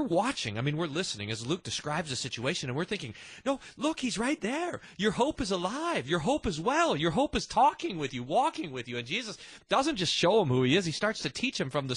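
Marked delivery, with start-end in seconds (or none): 3.5-9.0 higher, insistent call to the unseeing disciples; urgent excited plea. Pretend. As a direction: plead with them that hope is right there. Listener urging the despairing disciples.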